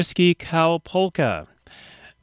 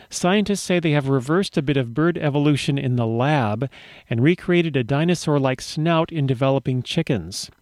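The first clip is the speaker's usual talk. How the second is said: usual speaking voice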